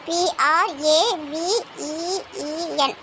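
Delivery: slow, letter by letter; spelling out slowly. Acted. Searching the phone for Praveen's number, spelling out slowly. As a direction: pause between each letter.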